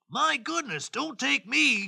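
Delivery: high-pitched goat plea; high, mildly alarmed storybook goat; pleading middle goat. Acted. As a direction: plead as the second billy goat. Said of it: "said the second billy goat".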